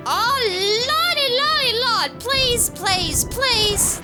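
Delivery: high, drawn-out begging; panicked plea. Real, not acted. Gamer begging the game as they nearly die, panicked plea. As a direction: plead in a high wail for survival.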